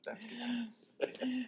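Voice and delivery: soft unvoiced whisper. Real, not acted. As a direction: breathe it as an aside. Whispered aside.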